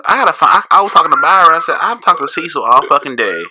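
high, loud, breathless rush; frantic retelling. Retold or imitated. Telling cohost about searching all day, high frantic voice. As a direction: tell it like you're still panicking.

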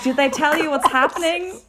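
a hint of the nurse. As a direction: ask it like a casual nurse. Nurse voiced in story.

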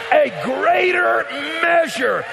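"greater" hit high and loud, each word drawn out slowly; slow shouted echo. Acted. Pastor repeating the blessing for weight, slow emphatic shout.

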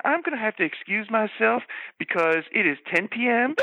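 usual speaking voice

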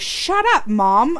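put-on high, sassy kid retort; bratty kid. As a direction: snap like a defiant kid. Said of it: "he goes".

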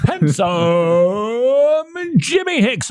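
drawn-out, laughing game-show announcer build-up; slow, laughing showman intro with a high lift. Acted. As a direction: roll the name out slow like a ring announcer. Host introducing his co-host.

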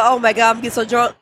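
normal talking voice

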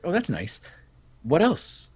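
high, airy feigned indifference; mock nonchalant.

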